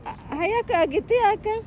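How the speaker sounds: high eager coaxing; excited urging. Real, not acted. Urging child, rising pitch.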